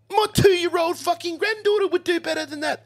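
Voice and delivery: put-on very high, loud Kiwi accent. Graham running down the kid's work, high Kiwi accent. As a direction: rubbish his work in a shrieky mock Kiwi accent. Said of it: "this old Kiwi fella there".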